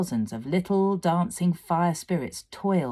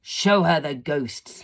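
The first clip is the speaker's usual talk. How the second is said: slow, loud demon command; cried out fire fiend; 5 dB louder than usual. A narrator's reading. Fire fiend commanding his demons, cried out. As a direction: call it out, gleeful. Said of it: "he cried".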